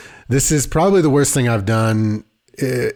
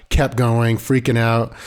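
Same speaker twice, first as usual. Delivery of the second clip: ordinary talk, nothing marked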